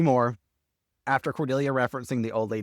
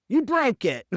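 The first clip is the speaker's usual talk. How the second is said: put-on much higher, indignant old ghost lady; 13 semitones higher, 4 dB louder, slower than usual. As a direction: play the offended ghost pointing at her broken fireplace. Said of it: "she goes".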